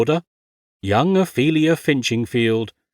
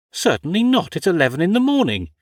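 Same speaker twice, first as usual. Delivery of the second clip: prim indignant lady; 8 semitones higher, faster than usual. Acted. Ophelia indignantly refuses whisky.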